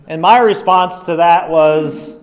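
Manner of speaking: ordinary talk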